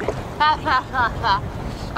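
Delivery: short cold laugh; breathy laugh. Real, not acted. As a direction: laugh through frozen lips. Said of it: "I can hardly talk".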